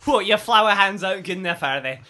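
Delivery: needling Scottish challenge; Scottish accent. Pretend. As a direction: tease in a put-on Scottish accent. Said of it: "you now gain a foreign accent".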